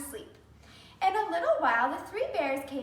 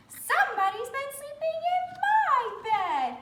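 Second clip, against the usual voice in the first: mother bear voice; 11 semitones higher, 3 dB louder than usual. Acted.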